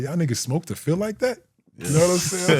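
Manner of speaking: high, disbelieving question; can't believe anyone enjoys this; baffled rookie asking his teammates on the bus; incredulous. Retold or imitated.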